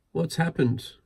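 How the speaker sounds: asking anxiously, voice up high; mildly anxious question. A narrator's reading. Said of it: "he asked anxiously".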